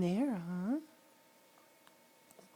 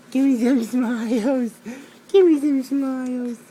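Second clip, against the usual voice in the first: loud, sing-song coaxing for smiles; excited coaxing; 8 dB louder than usual. Real, not acted. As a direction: coax a smile out loudly. Dad coaxing baby to smile.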